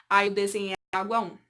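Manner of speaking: ordinary delivery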